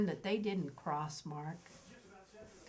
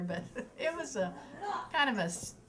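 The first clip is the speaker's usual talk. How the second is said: ordinary talk, nothing marked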